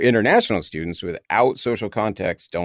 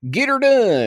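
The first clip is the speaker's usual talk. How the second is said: higher, louder mock southern accent; 4 dB louder than usual. Host giving an example of comic American characters, southern accent. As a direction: put on a hammed-up southern accent. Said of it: "equivalent to a southern accent".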